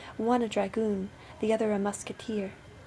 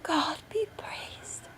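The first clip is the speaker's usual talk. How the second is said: high, soft, slow thanksgiving; joyful relief; 7 semitones higher, 5 dB quieter, much slower than usual. A narrator's reading. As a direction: breathe out thanks, overjoyed. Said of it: "replied the poor wife joyfully".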